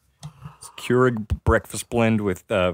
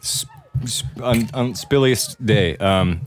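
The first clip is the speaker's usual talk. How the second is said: ordinary talk, nothing marked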